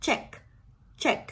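"check" with a quick burst of air on CH; clench, then puff out the short CH of "check". Shown as a demonstration. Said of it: "พ่นเอาลมออกมาเลย". ("check" กัดฟันพ่นลม ch สั้นๆ)

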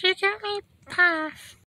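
very high, slow little creature squeak; Jigglypuff. Acted. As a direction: chirp the name, drawn out. Creature says its name.

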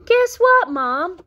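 high, loud excited youngster; eager storybook kid; bursting with news. Acted. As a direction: pipe up proudly, pitch way up. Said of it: "said Froggy".

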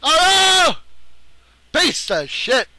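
very high, loud drawn-out cry; anguished scream. Real, not acted. Gamer thwarted in the chase, anguished scream. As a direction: wail it at full volume.

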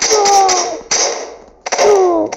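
very loud high cries; pained shouts. Real, not acted. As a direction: cry out as you get hit. Getting hit in game.

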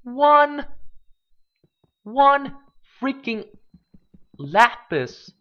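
high, loud thrilled cry; excited shout. Real, not acted. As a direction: shout it out in delight at the find. Thrilled at finding lapis.

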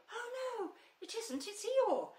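high, loud startled exclamation; surprised cry. Acted. Pooh seeing Eeyore float out, startled cry. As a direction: realise it's Eeyore, astonished.

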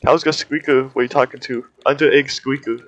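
usual speaking voice

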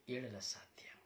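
hushed whisper to the congregation. Real, not acted. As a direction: lean in and whisper it. Preacher drops to a whisper. (ಪಿಸುಮಾತಿನಲ್ಲಿ)

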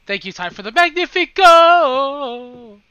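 slow, loud mock gratitude; loud sarcastic thanks. Real, not acted. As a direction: shout the thanks slowly, sarcastic.

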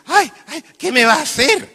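high, squeaky mock woman gasping; breathless lady. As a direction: ham up a flustered dreaming woman. Dreaming woman voiced in joke. (voz aguda y fingida de señora asustada)

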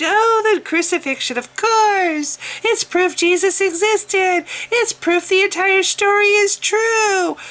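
high, slow, mock-pious voice. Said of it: "Love my voice".

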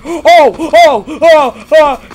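loud high repeated yelps; scared yelps. Real, not acted. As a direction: yelp at each jump scare.

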